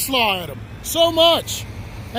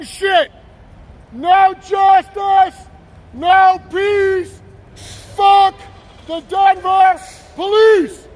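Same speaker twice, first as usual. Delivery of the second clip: real angry shout. Real, not acted. Man challenging Denver police to do something, shouting angrily. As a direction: bellow slogans and insults across the street. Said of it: "I'm a little angry".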